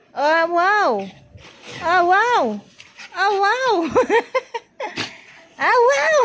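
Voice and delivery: escalating mock amazement breaking into giggles; building playful wow. Cheers climb into laughter.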